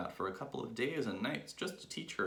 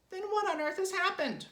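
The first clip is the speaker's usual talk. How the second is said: very high, loud woman's squawk; Mrs. Twit's alarmed cry; 6 dB louder than usual. A narrator's reading. Wife questioning her husband about the stick, high alarmed cry. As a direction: cry out in a high-pitched character voice. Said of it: "cried Missus Twit".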